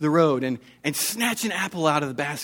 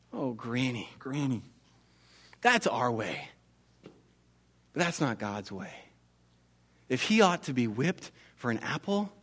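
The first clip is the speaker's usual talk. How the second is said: gentle Martin; 2 semitones lower, 6 dB quieter than usual. Acted. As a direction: coax her kindly toward mercy. Martin gently reasons with her.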